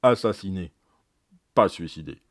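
each phrase set apart by a pause; measured, clearly separated dying testimony; very distinct. Retold or imitated. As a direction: pronounce it very distinctly, dropping at the end. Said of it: "elle m'a dit très distinctement".

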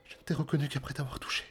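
high, broken half-whisper of joy and tears; the old nurse chokes up as she recognises him; voix brisée, aiguë, presque chuchotée. Acted. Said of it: "Elle dit d'une voix brisée".

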